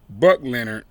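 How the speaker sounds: ordinary delivery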